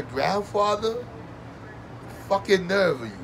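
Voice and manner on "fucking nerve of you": ordinary talk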